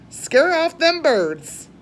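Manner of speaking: loud, high, slow command; ordering. Acted. Old lady telling the rabbit to scare birds, loud and slow. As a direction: draw out the order loudly.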